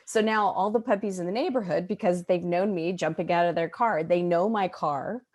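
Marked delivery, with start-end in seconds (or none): none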